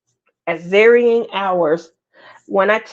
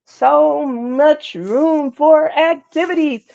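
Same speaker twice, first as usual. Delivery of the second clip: quoted movie line; 6 semitones higher, slower than usual. Retold or imitated.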